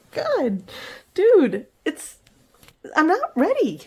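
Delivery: high, loud mock-exasperated dog voice; voicing the dog. Owner imitating her dog grumbling at bedtime, high mock exasperation. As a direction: play the dog, fed up at being rushed to bed. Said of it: "he looks at me like".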